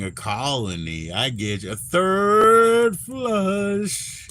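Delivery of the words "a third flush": long, drawn-out amazed high "A third flush.". Real, not acted. Host marveling at a guest's third mushroom flush, awed and stretched out. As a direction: stretch it out in awe.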